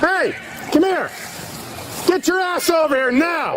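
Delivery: hollering for him to come; calling from far away. Real, not acted. Cop calling to fled suspect.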